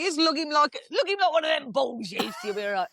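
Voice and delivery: high, laughing mock shout; laughing retelling. Teller retells telling undertakers to lift dad like a bull, laughing. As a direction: laugh through a cheeky boast. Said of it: "I was like".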